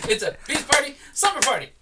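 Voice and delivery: high laughing chant. Real, not acted. Laughing correction.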